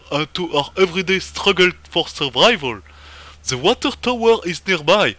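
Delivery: loud, high mock Russian accent. Official sends comrade to the water tower, hammed-up Russian accent. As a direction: declaim like a comic Soviet comrade in a Russian accent. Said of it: "c'est marrant avec l'accent russe".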